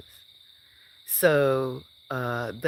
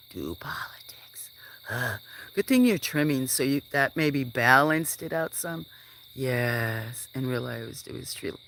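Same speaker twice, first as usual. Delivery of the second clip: ordinary talk, nothing marked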